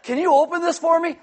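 high, sweet, pleading little voice; fake helpless plea. Wife asking husband to open the pickles, sweet high plea. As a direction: play helpless with the jar. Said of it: "fake sometimes that you can't".

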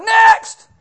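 high, loud, slow drill sergeant shout. Retold or imitated. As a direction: bark at the recruits like a drill sergeant. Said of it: "drill sergeant".

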